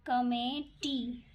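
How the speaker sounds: Committee stretched out syllable by syllable; drawn-out committee; slow dictation. Shown as a demonstration. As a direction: say committee slowly as if writing it. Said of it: "स्वर पहले लिखाएंगे".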